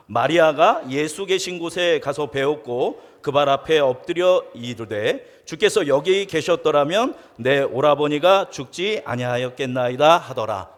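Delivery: slow, measured reading of the verse; scripture reading. Acted. Pastor reads verse 32 aloud, slow and measured. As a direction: read the verse deliberately.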